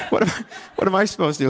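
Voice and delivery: fast, laughing, flustered excuse. Retold or imitated. Shopper making excuses to her own conscience, fast flustered laugh. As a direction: rattle it off laughing at yourself.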